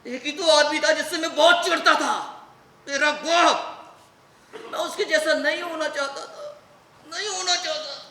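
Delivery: high, loud, emotional cry; overwrought, pained protest; anguished outburst. Acted. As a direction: start loud, then let it weaken on the repeat. Son's anguished outburst about father.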